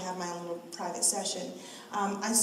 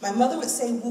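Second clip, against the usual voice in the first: normal talking voice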